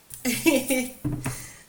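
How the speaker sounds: high, loud fit of laughter; real giggling laugh. Real, not acted. Reader can't help laughing at the card, laughing. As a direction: keep laughing, helplessly amused. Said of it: "Sorry for laughing".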